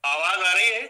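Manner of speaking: loud, high-pitched questioning call; calling out to check. Real, not acted. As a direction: raise your voice to test the connection. Checking if muted microphone works.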